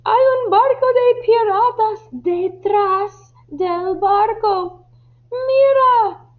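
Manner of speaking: high, urgent lookout's cry; alarmed shout. Acted. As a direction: call out the danger to the captain. Sailor finally warns of pirates behind. (grito agudo de alarma)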